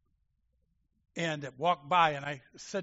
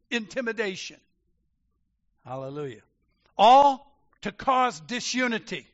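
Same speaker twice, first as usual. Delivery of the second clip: preaching at full force; 5 semitones higher, 8 dB louder than usual. Acted. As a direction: thunder it to the congregation. Preaching climax.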